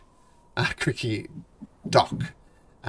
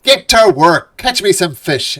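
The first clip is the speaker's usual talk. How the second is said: loud, high gruff order; barking orders; 7 semitones higher, 13 dB louder than usual. Acted. Dwarf foreman stomps and orders the party to fish. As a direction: bark it while stomping feet. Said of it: "he stomps his feet".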